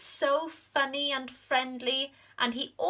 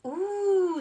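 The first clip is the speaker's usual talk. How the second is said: high, wondering ooh; little bees; 10 semitones higher, 4 dB louder than usual. Acted. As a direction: coo like tiny curious bees. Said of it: "said the little bees".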